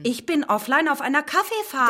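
lauter, höher nachgesetzt; empört wiederholen; empörte Wiederholung. Acted. (laut und empört wiederholt)

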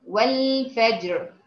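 والفجر slowly, qalqala on jeem then a heavy raa; slow correct recitation of والفجر; correct demo. Shown as a demonstration. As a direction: bounce the jeem and land on a full raa in والفجر. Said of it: "أر، أر،".

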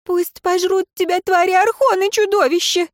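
high furious shout; helpless rage. A narrator's reading. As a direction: scream the curse in helpless fury. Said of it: "выкрикнула в бессильной злобе".

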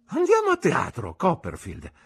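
high, loud proposal, dropping on the name; calling out. A narrator's reading. Tipsy companion proposing the theatre to narrator, calling out. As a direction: call it out with a high start.

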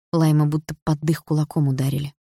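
usual speaking voice

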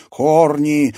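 loud, high growled cry; possessed seer crying out; possessed roar. A narrator's reading. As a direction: roar it in a voice not your own. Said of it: "не своим голосом прорычала".

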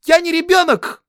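very high, loud shriek; girl's outraged scream. A narrator's reading. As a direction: scream it like an offended young girl. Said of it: "закричала она". (возмущённый девичий крик)

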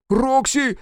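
high-pitched call of her name; frantic yell. Acted. Engineer calls woman as time runs out, frantic yell. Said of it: "снова завопил Сальф".

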